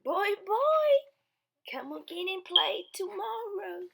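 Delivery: put-on high, loud little baby voice; Baby Blair. Baby Blair reporting the bowl is empty, high baby voice. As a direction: chirp it out. Baby character says goodbye.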